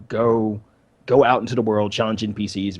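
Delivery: ordinary talk, nothing marked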